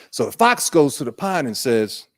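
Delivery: usual speaking voice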